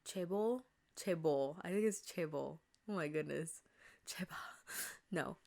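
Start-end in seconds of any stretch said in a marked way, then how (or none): none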